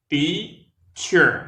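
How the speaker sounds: Teacher said high and loud, TEA stressed, clear ch; two syllables TEA-cher; model pronunciation. Shown as a demonstration. Teacher modelling Teacher for learners. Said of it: "En mettant la langue entre les dents".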